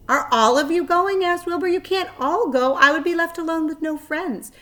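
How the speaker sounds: high, loud anxious pleading; pleading pig. Acted. Wilbur pleading with the spiders not to go, anxious. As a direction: beg them not to leave.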